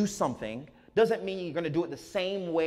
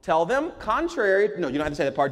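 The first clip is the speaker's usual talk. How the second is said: usual speaking voice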